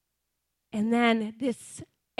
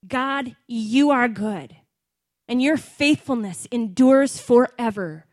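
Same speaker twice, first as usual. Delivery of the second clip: 1 loud declaration; 8 dB louder than usual. Shown as a demonstration. Declaring praise in sermon.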